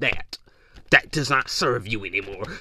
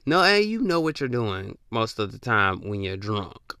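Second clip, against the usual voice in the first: usual speaking voice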